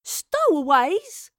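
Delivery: very slow, high, loud outraged disbelief; indignant exclamation. A narrator's reading. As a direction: throw the word back at him, stretched out. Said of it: "Astrid exclaimed".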